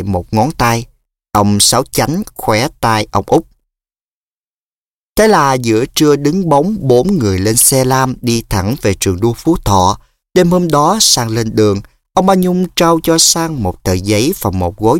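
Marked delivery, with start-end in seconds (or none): none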